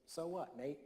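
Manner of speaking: lightly imitated New Zealand accent. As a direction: quote him with a hint of a New Zealand accent. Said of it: "with the New Zealand accent".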